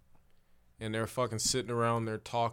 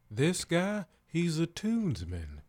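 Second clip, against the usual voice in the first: higher, slow put-on voice; band guy voice; 6 semitones higher, 4 dB louder, slower than usual. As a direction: lay it out like a proud bandmate. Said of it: "He's like".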